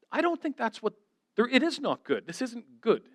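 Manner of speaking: high, exasperated disbelief; indignant protest. Retold or imitated. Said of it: "And I'm like".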